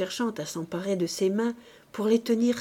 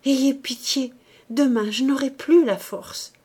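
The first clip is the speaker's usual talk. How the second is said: higher, louder supplication; pleading; 5 semitones higher, 6 dB louder than usual. A narrator's reading. Kneeling woman begs the priest to hear her now, pleading. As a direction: beg urgently for mercy. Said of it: "reprit-elle en se faisant suppliante".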